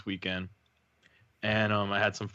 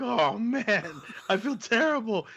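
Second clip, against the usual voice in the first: higher, hammed-up guilty apology; mock remorse; 11 semitones higher, slower than usual. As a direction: overplay the embarrassment. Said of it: "I'm like".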